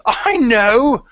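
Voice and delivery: loud, high burst of amused disbelief; laughing outburst. Acted. Blacktooth answers Pebble's confession about the ink, laughing. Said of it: "And he's laughing, and he says".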